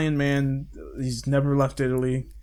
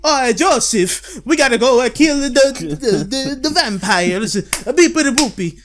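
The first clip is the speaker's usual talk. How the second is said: put-on Italian accent; 10 semitones higher, 8 dB louder than usual. Caesar rallying Joseph against vampires, hammed-up Italian accent. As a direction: ham up a silly Italian sidekick. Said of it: "But in English, he's like".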